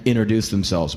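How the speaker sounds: normal talking voice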